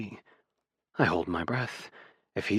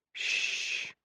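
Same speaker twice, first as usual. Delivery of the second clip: soft, whispered shush; chiding shush; 10 dB quieter than usual. A narrator's reading. Roommate trying to listen as the next number starts, chiding in a hush. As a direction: shush them softly in reproof. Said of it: "he chides".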